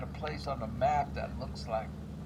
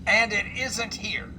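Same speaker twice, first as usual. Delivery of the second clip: louder, higher, slow disbelief; exasperated outburst; 8 semitones higher, 9 dB louder, slower than usual. Real, not acted. Player finding the car gone, loud slow disbelief.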